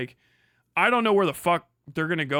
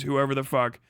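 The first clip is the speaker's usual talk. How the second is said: usual speaking voice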